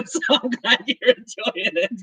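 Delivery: high-pitched, talking through laughter; laughing. Real, not acted. Host welcoming a first-time viewer, laughing. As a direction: let real laughter carry the words up.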